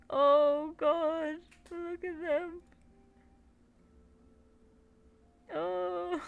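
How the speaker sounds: high squealing coo; adoring. Real, not acted. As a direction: melt over something adorable. Cooing over tiny plush.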